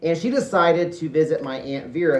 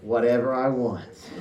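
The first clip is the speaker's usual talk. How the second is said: cool James Dean dad; 3 semitones lower than usual. Pretend.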